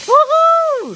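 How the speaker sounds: very high, loud whoop of victory; excited cheer. Acted. Steven cheering his win, excited high whoop. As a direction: shriek it out like a winner. Engine wins the race.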